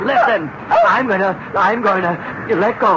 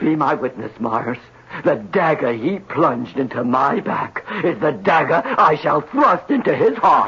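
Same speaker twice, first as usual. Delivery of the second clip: melodramatic vow; 8 semitones lower than usual. Acted. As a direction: vow revenge like a tragic hero. Vows revenge melodramatically.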